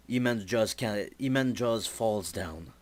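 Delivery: ordinary delivery, nothing marked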